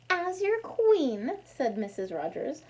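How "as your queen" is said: regal queen voice. Acted. Missus Rogers plays queen.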